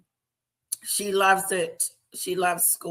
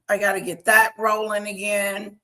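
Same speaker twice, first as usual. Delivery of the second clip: usual speaking voice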